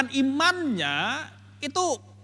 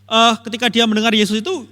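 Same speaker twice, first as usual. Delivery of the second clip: normal talking voice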